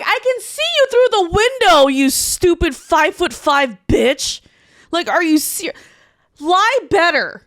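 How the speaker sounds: loud, high exasperated outburst; indignant retold shout. Woman at window catching ex lying, loud indignant re-enactment. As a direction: call out his lie at full voice.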